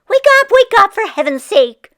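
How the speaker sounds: high, loud wake-up cry; fed-up wife shouting at her sleeping husband; exasperated shout. Acted. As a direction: shake him awake with your voice. Wife shaking husband awake.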